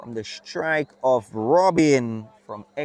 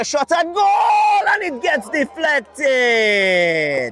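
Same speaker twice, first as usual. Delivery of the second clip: excited shout; 10 semitones higher, 4 dB louder, much slower than usual. Real, not acted. Live goal attempt, commentator erupts.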